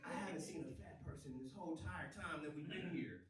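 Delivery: low, soft imitation of his kid; his young son. Son quietly telling dad what he noticed, understated imitation. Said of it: "my son looked at me".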